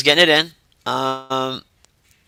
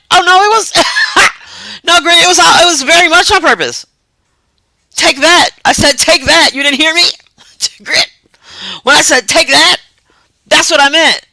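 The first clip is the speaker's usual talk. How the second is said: high, louder, teasing triumph; gleeful gloating; 9 semitones higher, 4 dB louder than usual. Real, not acted. Streamer gloating to Grit about the jab. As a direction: rub it in with glee.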